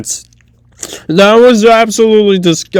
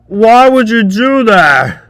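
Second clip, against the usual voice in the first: normal talking voice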